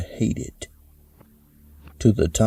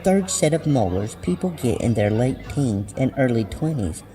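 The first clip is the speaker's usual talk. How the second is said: put-on higher girlish voice reading out search results; teenage friend reporting; 6 semitones higher than usual. Friend reads the search results to her, higher girlish voice. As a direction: read it off to a friend in a high young voice.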